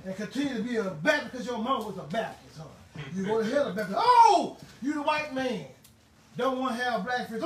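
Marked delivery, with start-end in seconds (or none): 4.0-5.7 mocking oh. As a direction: throw out a big scornful oh.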